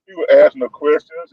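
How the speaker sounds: usual speaking voice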